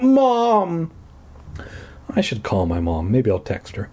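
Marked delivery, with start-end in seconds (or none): 0.0-0.9 happy shout. A narrator's reading. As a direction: burst out with joy. Joyful hug.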